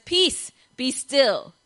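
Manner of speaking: slow, drawn-out calm pronouncement; mock serene command. Friend woken in a storm answering with slow calm. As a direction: say it slowly like an unbothered sage. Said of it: "your friend gets up and says".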